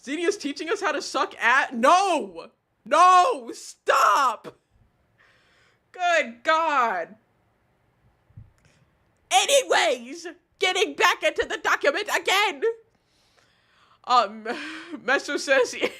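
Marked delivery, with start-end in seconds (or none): 1.7-7.1 high, loud protest; appalled shouting. Real, not acted. Cuts off an embarrassing chat message. 9.3-12.6 high, singsong change of subject; forced segue. Real, not acted.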